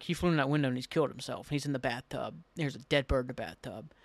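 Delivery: low, soft worried thought; quietly worried. Retold or imitated. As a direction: think the worst aloud, hushed and low. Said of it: "starting to get worried".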